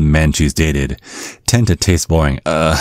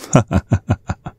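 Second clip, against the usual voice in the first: dad's chuckle; 6 semitones higher than usual. Acted. Dad chuckles after makeup jab.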